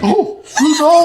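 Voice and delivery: high, slow, laughing squeal of disbelief; starstruck squeal. Retold or imitated. Starstruck reaction retold.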